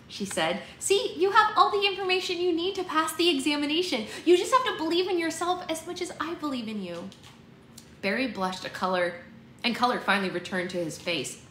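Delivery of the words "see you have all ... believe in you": high-pitched, earnest pep talk; encouraging sister. Acted. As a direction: build up a doubting brother. Voices the sister encouraging her brother.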